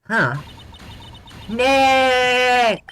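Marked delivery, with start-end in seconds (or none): 1.5-2.8 high, loud, sustained yell; stretched-out high shout; long shout. Acted. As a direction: holler the name and hold it. Calling out to trigger the camera.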